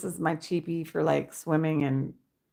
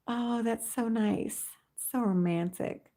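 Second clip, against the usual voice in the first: soft gasp, touched; 5 semitones higher, 5 dB quieter than usual. Real, not acted. Soft awed reaction.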